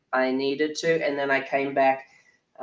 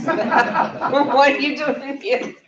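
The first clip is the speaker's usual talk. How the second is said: laughing, much higher and slow; delighted laughing question to a man in the room; laughing tease; 12 semitones higher, 6 dB louder, much slower than usual. Real, not acted. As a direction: tease him through a laugh. Laughs teasing a man in audience.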